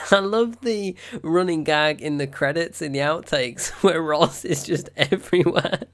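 loud, high giggling talk; real laughing fit. Real, not acted. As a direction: laugh through it. Laughing about Roz gag.

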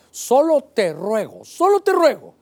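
rising to an earnest high cry; God begging the wife to stop drinking, pleading call. Acted.

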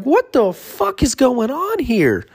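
very high, slow disbelieving shout; high comic panic; mock outrage. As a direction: squeal it in shock. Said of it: "And I'm like".

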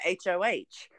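"H O H" said letter by letter, never as a word; "H O H" as three separate letters; spelled-out letters. Shown as a demonstration. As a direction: spell out "H O H" one letter at a time. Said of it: "I have to say it like that".

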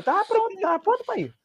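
squeaky high-pitched tease; high giddy confession; high excited. Retold or imitated. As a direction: pitch it way up, playful. Retells being ready, high voice.

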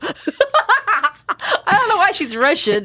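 high, slow words through laughter; laughing. Real, not acted. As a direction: crack up while getting it out. Cracking up at own accent.

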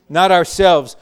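usual speaking voice

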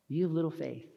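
soft, tender chiding; gentle Jesus. Acted. Jesus to Peter as he catches him, gentle. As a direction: say it softly, with fond patience. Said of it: "that's how Jesus would say it".

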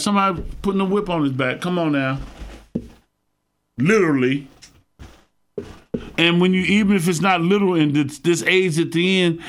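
ordinary delivery

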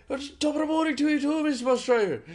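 very high, loud Irish greeting; exuberant Irish accent. Retold or imitated. Laughing mate echoing the greeting to the driver, exuberant Irish accent. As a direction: holler it like a merry Irishman. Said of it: "speaking like Irishmen".

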